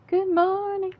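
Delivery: sung-out high good morning; high silly greeting. Acted. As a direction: greet in a high, loud cartoon voice. Repeated greeting, second take changed.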